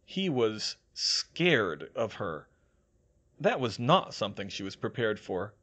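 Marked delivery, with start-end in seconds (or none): none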